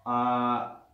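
ordinary talk

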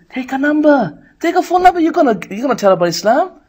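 pushy shaitan voice. Retold or imitated. Shaitan prompting.

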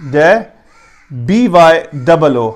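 normal talking voice

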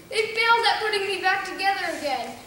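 ordinary talk

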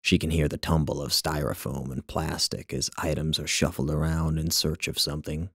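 plain reading voice

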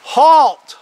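loud, high-pitched yell; yelled command. Retold or imitated. Lawman to fleeing robber a hundred yards off, yelled. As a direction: call out sharply across a long distance. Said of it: "he yelled".

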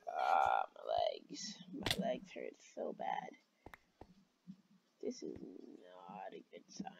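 weak, whispered mock agony; pained whisper. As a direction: whisper as if too hurt to speak. Playing hurt after a fall.

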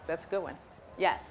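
ordinary delivery

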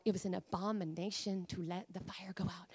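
low, near-whispered warning; hushed gravity. Real, not acted. Preacher telling church the fire must never go out, hushed. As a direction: drop to a hush for the solemn point.

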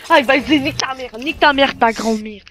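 loud angry rant; raging gamer. Real, not acted. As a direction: swear loudly in rage. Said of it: "on rage".

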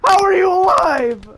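high, loud, stunned disbelief; shocked shout. Real, not acted. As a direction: yell in amazement at surviving. Surviving a huge blast.

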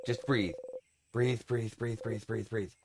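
calm yourself down, fading; self-soothing hush. Acted. Talking himself down.

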